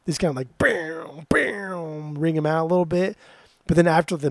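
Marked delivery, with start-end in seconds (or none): 0.6-2.1 drawn-out distorted guitar twang; imitated guitar noise. Co-host shows how guitars make noise, imitating them. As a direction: mouth a ringing electric guitar crash. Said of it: "heavy, distorted guitars leads".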